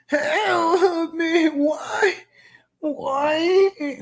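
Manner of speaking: high, wailing mock whine; hammed-up imitation of a desperate plea; whining parody of the politician's voice; mock drowning whine. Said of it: "She sounds like she's drowning".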